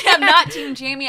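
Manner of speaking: laughing loudly through the words; laughing denial. Real, not acted. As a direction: laugh it off while protesting.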